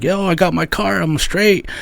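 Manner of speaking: imitating a bragging friend; mock friend voice. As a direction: play the smug buddy lightly.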